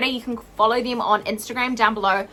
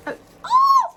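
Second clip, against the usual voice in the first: very high, loud excited squeal; delighted squeal; 4 dB louder than usual. Real, not acted. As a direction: squeal with delight at a cute surprise. Delighted discovery while unboxing a gift.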